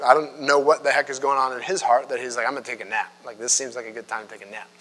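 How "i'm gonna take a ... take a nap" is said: lazy Jonah. Retold or imitated. Voicing Jonah before his nap.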